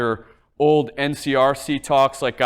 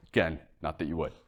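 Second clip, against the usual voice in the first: teasing aside; 12 semitones lower, 12 dB quieter, much faster than usual. Acted. Teasing aside about staying.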